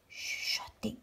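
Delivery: breathy hushed whisper. Shown as a demonstration. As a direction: whisper the word. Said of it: "S'il parle à voix basse".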